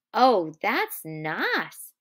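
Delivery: drawn-out, swooping delight; slow, dreamy enjoyment; savouring pleasure. Acted. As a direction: linger on each word with simple pleasure. Lenny blissfully strokes hair.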